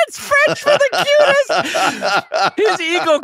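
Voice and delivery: words pushed out high and loud through laughter; laughing. Real, not acted. Cohost explains the joke to Brad while laughing hard. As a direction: still cracking up, voice way up.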